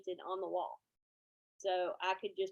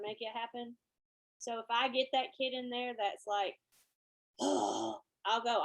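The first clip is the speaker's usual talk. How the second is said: ordinary delivery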